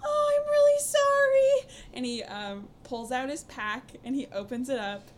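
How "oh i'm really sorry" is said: much higher, louder sheepish voice; a high, squeaky apology from a caught kid; much higher and louder, hammed-up sorry; Anton apologizing. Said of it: "Anton says".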